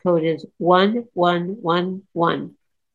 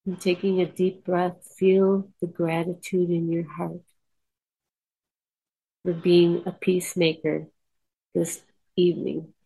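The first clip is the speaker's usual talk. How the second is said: soft, unhurried meditation voice; guided meditation; 3 dB quieter than usual. Acted. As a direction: lead the listeners calmly inward.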